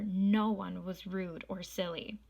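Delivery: usual speaking voice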